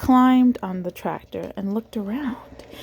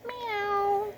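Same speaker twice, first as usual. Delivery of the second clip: soft meow, much higher; a soft, high kitten mew; cat meow; 13 semitones higher, 6 dB quieter than usual. A narrator's reading. Reading to a child, Cat meowing softly and much higher. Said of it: "said Cat".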